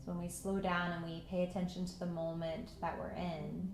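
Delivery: calm meditation voice with long pauses; unhurried, even guidance into stillness; guided meditation. Acted. Yoga teacher settling students into breath and patience, guided meditation.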